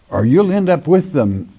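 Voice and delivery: usual speaking voice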